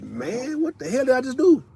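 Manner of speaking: high, rising stunned question; amazed disbelief. Retold or imitated. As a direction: sound amazed at yourself. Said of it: "and you like".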